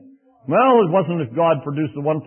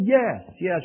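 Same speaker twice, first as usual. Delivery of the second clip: ordinary delivery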